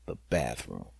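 low, soft, grim the bathroom; quiet dread; ominous dread. Retold or imitated. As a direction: drop to a low, dreading murmur. Dreaded last room.

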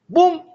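high, loud sharp bang sound; sudden bang. Retold or imitated. Neighbor lashing out at the dog, loud bang. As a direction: make the sudden bang of the blow.

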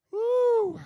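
high excited "woo" cry; preacher's whoop. Real, not acted. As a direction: let out a sudden high whoop. Preacher exclaims after lowering voice.

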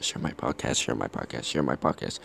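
soft, low hidden-message murmur; subliminal whisper. Shown as a demonstration. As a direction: slip it in under the breath like a hypnotist. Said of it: "plant subliminal message in your head".

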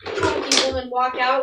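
ordinary talk, nothing marked

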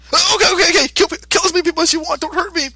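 grovel fast so he won't hurt you; panicked backpedal. Retold or imitated. Boo gives him a look.

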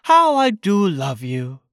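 tender declaration to a dear friend; fond Christopher Robin. A narrator's reading. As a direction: linger lovingly over each word. Christopher Robin fondly addresses Pooh.

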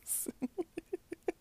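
high, sheepish laugh; embarrassed giggle. Real, not acted. Laughing at her own confession, sheepish giggle. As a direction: laugh it off nervously.

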